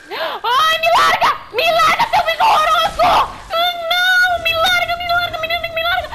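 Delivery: high panicked shrieks, half laughing; terrified screaming. Real, not acted. Attacked by creature, screaming.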